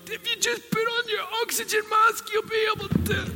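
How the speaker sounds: very high shouted mock voice; shrill mocking voice. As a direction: squeal it like a nagging caricature. Mocking preachy people.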